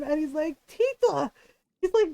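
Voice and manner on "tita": pitched-up, hurt appeal; pained. Retold or imitated. Cousin clutching his stomach to his aunt, pained. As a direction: imitate a wounded nephew pleading. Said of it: "he's clutching his stomach and his arm".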